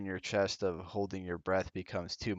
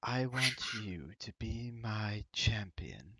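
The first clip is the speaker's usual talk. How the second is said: mysterious goddess voice; 5 dB quieter than usual. Acted. GM voices the raven woman.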